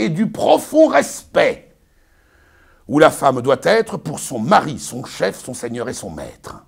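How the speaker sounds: pompous Arnolphe, lecturing his bride; booming, sermonizing stage declamation; hammy stage actor. Acted. Performs Arnolphe's tirade.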